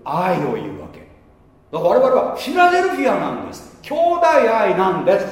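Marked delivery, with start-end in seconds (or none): 1.7-5.3 raised, emphatic proclamation; fervent preaching. Real, not acted. Preacher proclaiming brotherly love to the congregation, raised and emphatic. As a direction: drive the point home. Preacher driving home his point.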